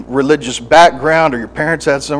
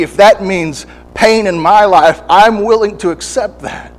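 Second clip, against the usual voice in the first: loud, much higher pleading vow; fervent surrendered prayer; 7 semitones higher, 5 dB louder than usual. Acted. Preacher acting a fervent prayer to God, loud and pleading. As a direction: cry out, willing to pay the price.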